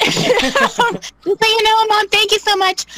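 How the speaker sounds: high, loud laughing thank-you. Real, not acted. Thanking absent mom, laughing.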